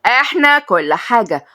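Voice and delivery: slow, high haughty boast; arrogant. A narrator's reading. Mother boasting slowly to the captive girl. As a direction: savor every word of power.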